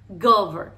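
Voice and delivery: "govern" firmly, V clearly buzzed; corrected "govern", strong English V; vibrating V. Shown as a demonstration. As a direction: buzz the middle of "govern". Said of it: "La V tiene que vibrar".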